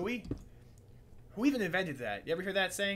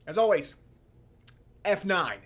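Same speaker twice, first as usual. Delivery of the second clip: louder, higher catchphrase; loud sign-off; 5 semitones higher, 10 dB louder than usual. Real, not acted. Vlogger announcing his usual sign-off, louder.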